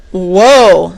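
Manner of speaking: amazed. A narrator's reading. Amazed at the jungle.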